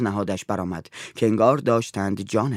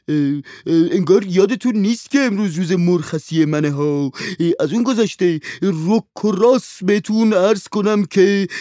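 whining servant; 8 semitones higher, 6 dB louder than usual. A narrator's reading. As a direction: moan about your bad luck, full of complaint. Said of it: "ناله کنان گفت".

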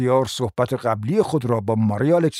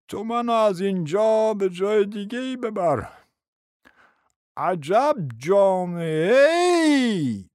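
slow, plaintive lament; wounded old prince; 8 semitones higher, much slower than usual. Acted. Prince begging his friend to take him away, slow lamenting. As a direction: drag the words out in hurt dismay. Humiliated prince begs to leave.

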